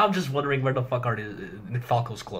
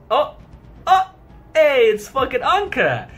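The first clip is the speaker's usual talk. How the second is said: excited outburst; 10 semitones higher, 9 dB louder than usual. Real, not acted. Spotting Anka in the panel.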